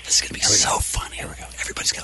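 hushed whisper, barely voiced. Real, not acted. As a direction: whisper so the call can't hear. Whispering during live call.

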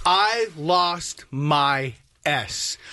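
low, very slow and spaced out; deadpan emphasis. Real, not acted. Storyteller confessing he got flustered, slow deliberate low delivery. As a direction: drag out each word, pitched low. Building up to the outburst.

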